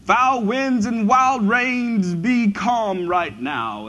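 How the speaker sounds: theatrical British stage voice, drawn out; mock British drama. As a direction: ham it up in a grand British accent at the storm. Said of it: "with this British accent of".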